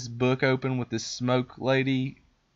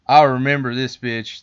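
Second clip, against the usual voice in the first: louder repeat; 9 dB louder than usual. Retold or imitated. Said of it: "I was like".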